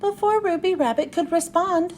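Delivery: usual speaking voice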